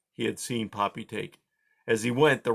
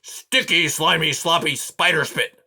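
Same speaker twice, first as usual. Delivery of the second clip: higher, louder cursing; angry swearing; 6 semitones higher, 6 dB louder than usual. A narrator's reading. Aerith piling up curses, louder and angry. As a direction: rattle off the curse in a temper. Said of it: "he swore out loud".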